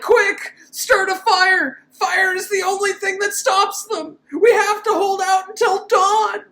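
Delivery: high, loud hammed-up urgent orders; mock urgent professor. Reviewer mimicking the professor rallying the camp, loud high mock panic. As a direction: bark the plan like a panicky B-movie hero. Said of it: "the professor's like".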